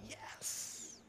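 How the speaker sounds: hushed, whispered cheer; low, breath-only exclamation; whispered yes. Retold or imitated. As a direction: whisper it as a secret celebration. Quoted private excitement, whispered.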